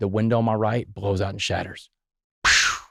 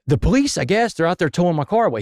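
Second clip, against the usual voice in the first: panicked friend; 7 semitones higher than usual. Retold or imitated.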